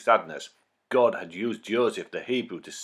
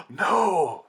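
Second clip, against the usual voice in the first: shocked gasp and cry; 9 semitones higher, 5 dB louder than usual. Acted. Listeners horrified at Pharaoh's decree.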